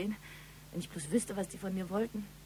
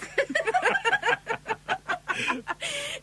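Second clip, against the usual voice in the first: long high burst of laughter. Acted. Long laugh at her own joke.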